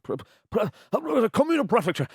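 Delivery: high, stammering mock struggle; flustered attempt. Author imitating himself failing in the booth, high flustered stammer. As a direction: trip over it, then blurt it out. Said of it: "And I'd be like".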